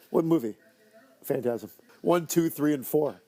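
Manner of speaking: normal talking voice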